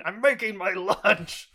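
high, loud, drawn-out mock yell; mock Del outburst. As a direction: wail it slowly and loudly as Del. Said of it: "just Del saying".

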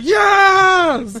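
high, loud, drawn-out cheer; long shouted hurrah; triumphant retold shout. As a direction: imitate a man yelling from his balcony. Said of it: "the guy comes back".

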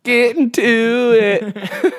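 mock eager voice. Jokes about killer's rampage.

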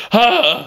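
high pained "ah" imitating a friend; mock pained cry. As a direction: yelp like the salts just hit. Imitating Matt's pain.

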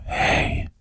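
a breathy, unvoiced "Hey."; retold eerie whisper from nowhere; ghostly whisper. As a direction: whisper it like an unseen voice passing by. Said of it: "Somebody whispered".

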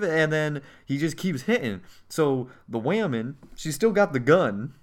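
usual speaking voice

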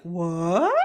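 long rising disbelief; mock shocked question. Isis hearing sister slept with Osiris, rising disbelief. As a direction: draw it out, sliding upward.

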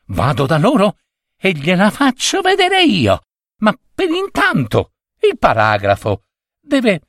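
put-on high, hurried young voice; eager boy Bob. A narrator's reading. Bob promising revenge to his boss, high and eager.

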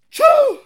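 very high, explosive "choo"; sudden high-pitched yelp; imitated loud noise. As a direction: blurt out a mock sneeze-like burst.

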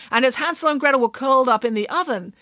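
ordinary delivery, nothing marked

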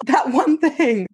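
thrilled to remember the snacks; a sudden happy gasp, then the words; gasping delight. Retold or imitated.

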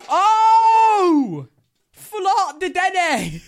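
very high, loud, drawn-out outburst; excited shout. Real, not acted. Card collector spotting a card, shouting in excitement. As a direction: whoop and holler over a find.

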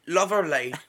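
hammy, badly faked British voice; bad British accent. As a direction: put on a clumsy British accent. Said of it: "say it like a British person".

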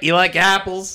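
high-pitched mock Boston accent; cocky movie-style Boston accent. Shown as a demonstration. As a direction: tease in a put-on Boston voice. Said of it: "how do you do a Boston accent".

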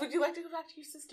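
quick, a hint of elf voice; lightly voiced elf. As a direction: ask it swiftly in character. Elf asks pixie.

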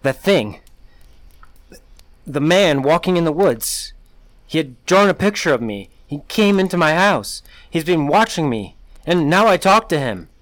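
woman telling a neighbour about the stalker; panicked. A narrator's reading. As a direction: spill it out in terror. Panicked account to Ralph.

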